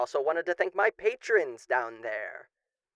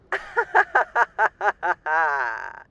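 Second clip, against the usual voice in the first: long loud villainous cackle; 4 dB louder than usual. Acted. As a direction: laugh like a demon welcoming a victim. After "enjoy your stay.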